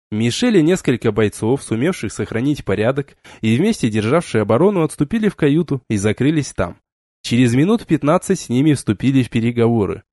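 usual speaking voice

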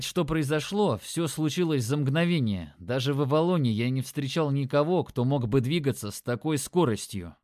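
plain reading voice

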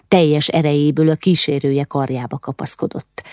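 usual speaking voice

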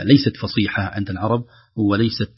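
usual speaking voice

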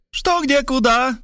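very high squawked questions; mock yell, pitched right up. A narrator's reading. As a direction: cry out in a high, startled voice. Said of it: "завопила она".

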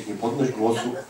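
preacher voicing Satan urging believers to stay quiet; sly Satan voice. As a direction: hush them like a sneaky devil. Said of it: "Magister inżynier szatan mówi do ciebie".

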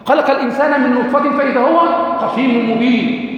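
Quran recitation. Acted. Teacher recites string of verses.

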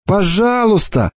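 put-on slow tearful girl's plea; pleading. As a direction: stretch the plea out, imploring. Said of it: "снова взмолилась она".

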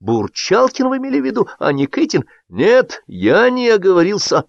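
high, insistent question and denial; high-pitched firm contradiction; argumentative back-and-forth. A narrator's reading. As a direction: contest it point by point. Quarrel over a supposed slip.

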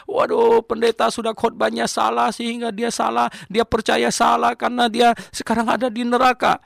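high, loud indignant lament; retold outburst of an angry man in hell; angry complaint. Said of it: "dia panas sekali dan dia marah".

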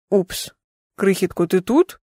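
understated puzzled surprise; surprised. A narrator's reading. Caller suddenly unsure who is listening, mildly surprised. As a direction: check in, caught off guard. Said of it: "Чую здивування".

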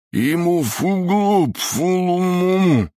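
high, slow gagged mumbling; muffled through gag. Acted. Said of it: "невнятную реплику".

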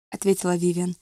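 usual speaking voice

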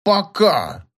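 mocking, hate-filled repeat; hateful. A narrator's reading. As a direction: throw the goodbye back with loathing. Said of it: "с ненавистью повторил Персиков".

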